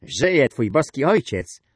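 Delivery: high, loud, slow ringing answer; young, clear voice. A narrator's reading. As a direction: proclaim it in a bright youthful voice. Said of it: "odparł młody, jasny głos".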